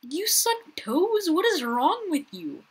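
high, slow, disgusted disbelief; incredulous high shout. Real, not acted. Gamer reacting to a player named I suck toes. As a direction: squeal in shocked disgust.

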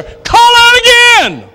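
slow, very high, stretched-out shouted call; drawn-out shout. Real, not acted. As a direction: hold every word like a final altar call. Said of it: "preaching climax repeat".

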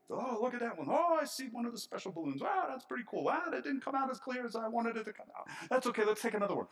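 high, fast, delighted chatter over the balloons; excited photo taker. Pretend. Preacher acts out snapping balloon photos, high and excited. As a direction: play someone giddy while snapping pictures.

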